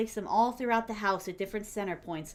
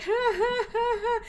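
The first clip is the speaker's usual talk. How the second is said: high, loud mock laughter; high-pitched jeering ha-ha-ha; 11 semitones higher, 4 dB louder, slower than usual. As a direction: imitate someone laughing in his rival's face. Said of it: "Chris starts laughing at him and mocking him".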